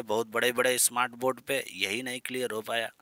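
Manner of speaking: ordinary delivery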